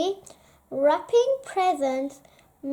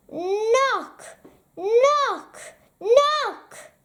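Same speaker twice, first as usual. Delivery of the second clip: rising knock knock knock, higher each time; knocking sound; 7 semitones higher, much slower than usual. Acted. Mole knocking on Badger's door, rising knock sounds.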